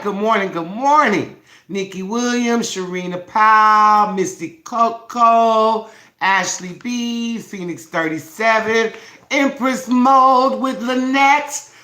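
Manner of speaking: high, loud roll call, names drawn slowly; roll call hype. Acted. Host calling roll of viewers, high and loud. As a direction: call each name like an announcer.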